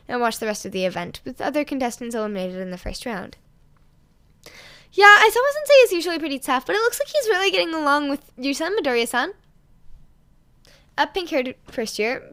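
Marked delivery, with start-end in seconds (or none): 4.9-9.3 high, loud, bright schoolgirl; a sunny student reassuring a mom; upbeat girl voice; cheerful girl. Acted.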